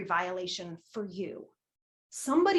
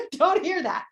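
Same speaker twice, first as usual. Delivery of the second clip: laughing; 7 semitones higher, 7 dB louder than usual. Real, not acted. Laughing while joking.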